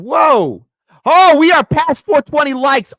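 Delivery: high, loud delighted cheer; excited whoa. Real, not acted. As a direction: celebrate the milestone. Likes milestone passed.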